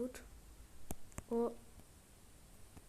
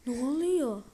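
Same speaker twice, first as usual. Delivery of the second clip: loud startled what's happening; startled shout; 3 semitones higher, 7 dB louder than usual. Real, not acted. Gamer startled by sudden event, loud alarmed shout. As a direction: blurt it out in alarm.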